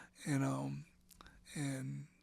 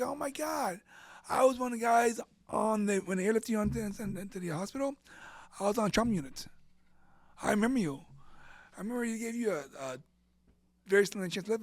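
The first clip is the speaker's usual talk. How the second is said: emotional recall; 6 semitones higher, 5 dB louder than usual. Retold or imitated. Doctor moved, remembering trauma unit.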